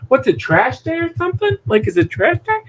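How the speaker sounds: high, puzzled, totally oblivious; mock-innocent voice, way up high; clueless husband. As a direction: play the husband who has no idea what he forgot. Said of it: "I'm like".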